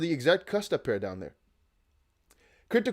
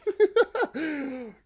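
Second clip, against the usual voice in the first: high laugh; 11 semitones higher than usual. Real, not acted. Laughs at own auctioneer bit.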